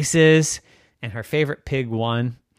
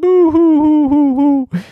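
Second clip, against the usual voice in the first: high, loud mock boo-hoo wail; hammed-up fake sobbing; fake crying; 10 dB louder than usual. Daphne pretends to cry.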